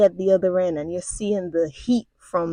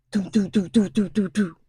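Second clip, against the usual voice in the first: gunfire imitation; 3 semitones higher than usual. Imitating the machine gun.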